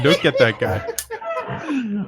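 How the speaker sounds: high, slow laughing delight; drawn out through laughter. Real, not acted. Repeated, laughing.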